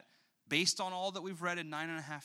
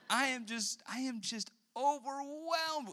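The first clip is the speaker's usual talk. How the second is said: much higher and slow, gushing; overwhelmed toddler; 10 semitones higher, slower than usual. Retold or imitated. A lightly voiced toddler saying she is overwhelmed. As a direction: sigh out joy like a small child. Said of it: "She didn't say that part".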